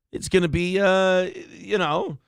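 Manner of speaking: ordinary talk, nothing marked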